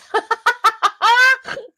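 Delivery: high staccato cackle; real high-pitched rapid-fire laugh; loud cackle. Real, not acted. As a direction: burst into loud, high ha-ha-has. Laughs at perturbed carved figure.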